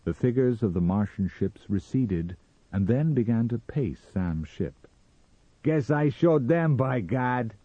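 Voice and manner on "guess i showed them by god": loud, high boast from Sam; crying out. A narrator's reading. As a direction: call it out in triumph. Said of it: "cried Sam".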